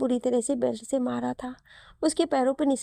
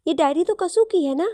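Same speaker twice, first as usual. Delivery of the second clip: surprised; 6 semitones higher, 6 dB louder than usual. A narrator's reading. Sister recognises missing girl's diary.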